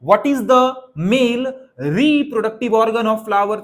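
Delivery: every word drawn out slowly for dictation. Shown as a demonstration. Teacher slowly dictating the exam question to the class. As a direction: spell the question out slowly so they can write.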